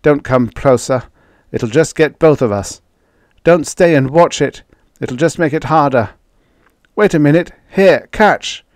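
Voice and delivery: panting heavily. A narrator's reading. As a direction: call out desperately while struggling. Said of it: "he panted heavily".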